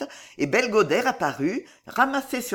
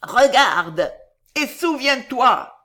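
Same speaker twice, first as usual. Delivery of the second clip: loud, urgent, drawn-out command; dying woman's cry; 6 semitones higher, 7 dB louder, slower than usual. A narrator's reading. Dying woman urging the girl to remember, loud cry. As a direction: cry it out, rising in pitch. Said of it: "cria l'agonisante".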